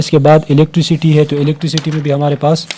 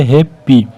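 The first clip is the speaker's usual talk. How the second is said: Happy broken in two, हैप-पी; split into syllables; slower than usual. Shown as a demonstration. Teacher letting students hear two sounds, happy split as हैप-पी. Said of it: "दो sound जो है वो".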